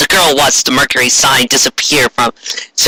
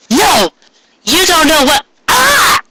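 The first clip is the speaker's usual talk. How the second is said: frightened cry; slower than usual. Acted. Ray shouting as the blast hits her, high cry. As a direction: yell no then get hit.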